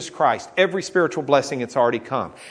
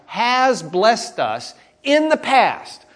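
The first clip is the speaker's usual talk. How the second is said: "has blessed us in the past" slow and raised; emphasis on past action; 6 semitones higher, much slower than usual. Shown as a demonstration. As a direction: spell out "has blessed us", then land "in the past". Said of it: "you want to emphasize that it happened".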